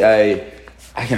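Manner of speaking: ordinary talk, nothing marked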